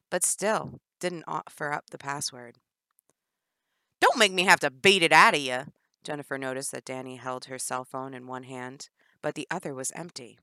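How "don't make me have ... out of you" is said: loud menacing warning; menacing threat. Acted. Danny threatening to beat Jennifer, loud. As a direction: threaten her with force.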